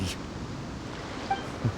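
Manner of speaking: quiet gleeful chuckle over the treasure; a soft, greedy little laugh. Acted. Greedy archaeologist gets divine relic.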